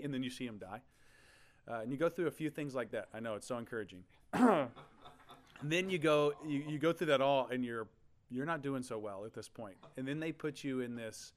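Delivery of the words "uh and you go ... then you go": ordinary talk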